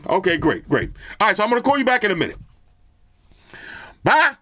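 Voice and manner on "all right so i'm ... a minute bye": hanging up the call with Amaya, half-yelling into the earbuds. Real, not acted. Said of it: "I feel like I'm yelling".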